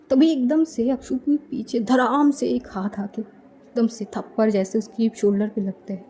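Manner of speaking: ordinary delivery, nothing marked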